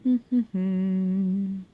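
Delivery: a little amused laugh to herself; light chuckle; short laugh. Real, not acted. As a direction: laugh at your own joke. Laughs at own joke.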